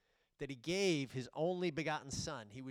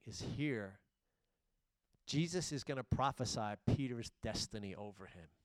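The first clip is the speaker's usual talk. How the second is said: ordinary delivery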